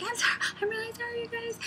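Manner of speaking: high, fast squeaky apology; squeaky retold sorry; mock flustered apology. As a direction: apologise in a hurried falsetto to the birds. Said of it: "I'm like".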